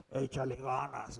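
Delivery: parodia en voz grave y queda; ánimo vago, paródico. Retold or imitated. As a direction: imita un consejo desganado, bajando mucho la voz. Parodia lo que Dios nunca diría. (ánimo de burla, grave y apagado)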